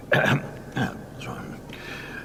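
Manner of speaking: soft throat clear. A narrator's reading. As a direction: clear the throat before speaking. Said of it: "clears his throat".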